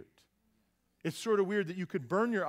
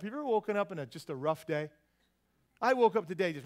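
ordinary delivery

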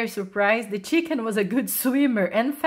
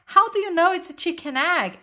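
high-pitched, curious challenge; puzzled question; 8 semitones higher than usual. A narrator's reading. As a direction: ask in wonder, voice lifted. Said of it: "asked Jessica".

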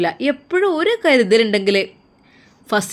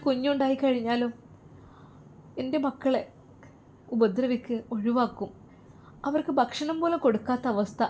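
much softer, choked and pleading; softly tearful; 10 dB quieter than usual. Acted. Navas confesses his fear for his children to his aunt, softly tearful. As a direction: barely voice the fear, close to tears.

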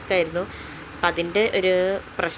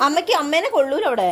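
firm raised voice; 9 semitones higher, 4 dB louder than usual. Real, not acted. Mother firmly telling her toddler she can't sit there, raised voice. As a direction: say it up high and firm so the little one listens.

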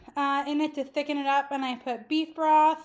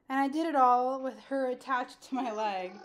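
normal talking voice

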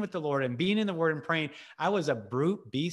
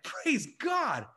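joyful exclaim; 10 semitones higher than usual. Retold or imitated. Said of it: "And I was like".

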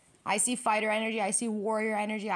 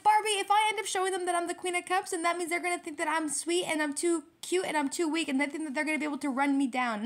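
high-pitched mock protest; stubborn; 8 semitones higher than usual. Virgo refusing to look sweet and weak, mock stubborn. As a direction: imitate a stubborn objector, starting high and fast, settling gradually. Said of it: "yo, stubborn energy for real".

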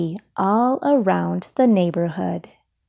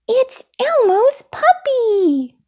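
very high, drawn-out puppet squeal; high, thrilled character shout; Elmo, delighted yell; 4 dB louder than usual. A narrator's reading. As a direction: cry out slowly with delight in a tiny high voice. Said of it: "yelled Elmo delightedly".

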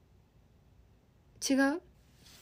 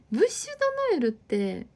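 laughing as she repeats ブッシュドノエル, higher; 6 semitones higher, 3 dB louder than usual. Real, not acted. Reading a viewer's comment back, laughing. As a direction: giggles out ブッシュドノエル in disbelief. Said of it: "ブッシュドノエルの顔ですね".

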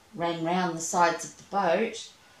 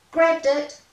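louder, higher shout of triumph; triumphant cry; 8 semitones higher, 10 dB louder than usual. Acted. Nicholas catching the worm, a loud triumphant cry. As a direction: crow it out in relief. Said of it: "cried Nicholas Alexander".

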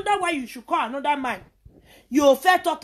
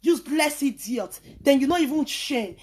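ordinary talk, nothing marked

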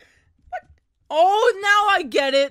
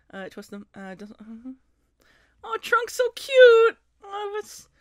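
usual speaking voice

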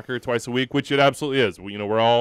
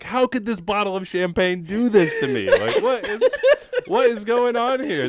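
much higher, laughing mock indignation at a bottle; laughing bewildered complaint; mock outrage; 10 semitones higher than usual. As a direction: act betrayed by the champagne, laughing. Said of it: "I was like".

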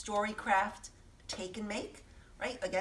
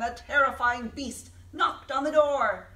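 spooky storyteller; 4 semitones higher, 8 dB louder than usual. Acted. Man telling a spooky story.

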